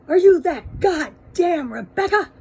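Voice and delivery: loud, high, slow furious bark; raging yell. Retold or imitated. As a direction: roar it at her like an enraged husband. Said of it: "he was just raging".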